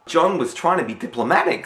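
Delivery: ordinary talk, nothing marked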